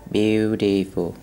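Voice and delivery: slow, stressed beau. Shown as a demonstration. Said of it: "từ gốc của nó là từ beauty".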